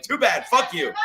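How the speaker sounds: brief mock yell of dismissal; retold yell. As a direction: throw it out as a retold taunt. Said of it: "I always yelled at him".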